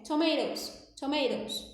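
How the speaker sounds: American "Tomatoes" with the t flapped into an r; American accent, to-MAY-rows; American English. Shown as a demonstration. Teacher shows her American "Tomatoes". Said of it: "o T muitas vezes ele é, ele vira um R".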